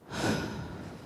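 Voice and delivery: a soft, voiceless out-breath; long release sigh. Shown as a demonstration. Therapist showing the audience how to release the breath, sighing. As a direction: let the breath go audibly, demonstrating. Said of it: "Take a long, deep breath. Release it".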